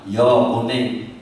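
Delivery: just give up and agree, slow and low; resigned giving in. Retold or imitated. Husband finally gives in to wife, slow and resigned.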